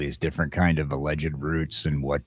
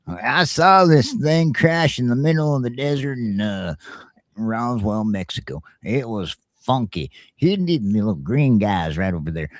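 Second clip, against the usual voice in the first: a hint of a higher, louder country witness; 6 semitones higher, 3 dB louder than usual. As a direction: play the backwoods guy who saw the crash. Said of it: "whether or not my redneck brethren was right".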